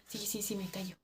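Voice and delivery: very soft, quick giving in; hushed compliance. Retold or imitated. Worker promising coworker to keep quiet, hushed. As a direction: agree under your breath.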